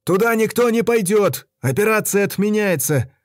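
shouted order. A narrator's reading.

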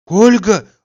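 a sudden high, loud alarmed outburst; frightened cry. A narrator's reading. Oblomov blurts out the name in fright, alarmed cry. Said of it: "вдруг вырвалось у испуганного Обломова".

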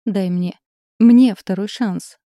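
pleading fervently, swelling loud; passionate plea. A narrator's reading. Husband begging wife for another chance, ardent. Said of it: "пылко произнёс Игорь".

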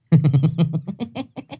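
high rising cackle of a smug winner; gloating chess player's laugh; cackling laugh. A narrator's reading. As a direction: crow with laughter over the chessboard. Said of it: "rồi thầy cười hành hạch".

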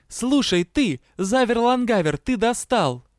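high, loud tirade; furious yelling. A narrator's reading. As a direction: yell it as if completely fed up. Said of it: "орала вампирша".

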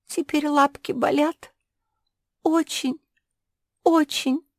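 high, plaintive little dog voice; mildly weepy high character voice; tearful. A narrator's reading. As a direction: complain pitifully, pitched well up. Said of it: "заплакала Шпиц".

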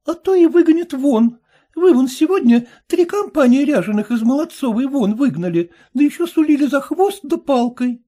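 put-on high young girl's voice, a bit pleading; daughter's voice. A narrator's reading. Daughter pleading with her father about mummers.

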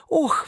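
high sharp gasp; a quick frightened "oh"; startled cry. Acted. Mergiona crying out at the mad palm, high. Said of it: "вскрикнула Мергиона".

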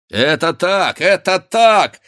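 loud, high, rising in force; rousing high-pitched shout; shouted agreement. A narrator's reading. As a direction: cry out eager assent like a whole crowd. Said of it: "закричали в один голос все хлопцы".

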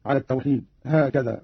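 ordinary delivery

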